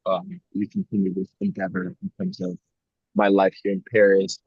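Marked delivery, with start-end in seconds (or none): none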